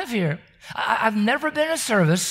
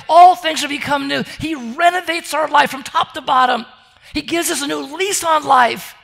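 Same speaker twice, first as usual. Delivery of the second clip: rising preaching; 5 semitones higher, 5 dB louder than usual. Acted. Preaching builds to full force.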